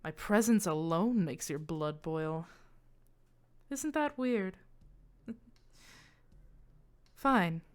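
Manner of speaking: lightly voiced, coolly provoking the child; taunting illusion.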